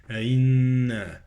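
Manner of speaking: "أئنا" with a long hummed double n; ghunnah on the nun. Shown as a demonstration. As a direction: hold the nasal n in "أئنا". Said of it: "النون بغنة".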